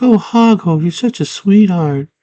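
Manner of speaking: high-pitched mock girl voice; put-on high girlish voice; girl character. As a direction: gush it high, as the girl in the story. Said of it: "She's like".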